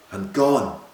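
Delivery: usual speaking voice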